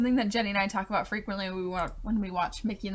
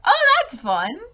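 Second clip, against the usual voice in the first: high, loud delighted whoop; excited cheer; 10 semitones higher, 6 dB louder, much slower than usual. Retold or imitated. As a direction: squeal with delight. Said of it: "I was just like".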